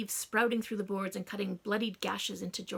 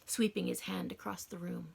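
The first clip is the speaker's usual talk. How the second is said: ordinary delivery, nothing marked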